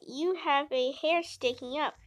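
ordinary delivery

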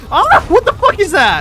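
calls out, then a sudden high yelp; startled scream. Retold or imitated. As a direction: jump in fright mid-sentence. Retold startled cry mid-fight.

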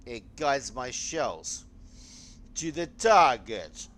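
slow, booming mock Russian proclamation; bad Soviet accent. Gamer to his viewers, in a bad Soviet accent. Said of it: "I had the worst Soviet accent ever".

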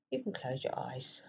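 guided meditation. Acted. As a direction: softly guide listeners into stillness, leaving space between phrases.

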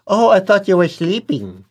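mock oblivious. Oblivious reply to dying person.

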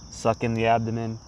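ordinary talk

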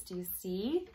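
ordinary delivery, nothing marked

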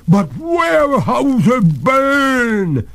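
very high, slow, loud protest; desperate ghost. Acted. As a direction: wail the objection, stretching it.

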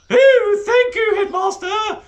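very high, loud voice behind a tuba; muffled voice. Acted. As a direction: call out from behind an instrument. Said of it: "came a muffled voice".

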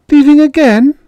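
high startled question; a boy caught off guard by the news; surprised child. Acted. Boy asking his mother, high and startled. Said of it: "I said to her".

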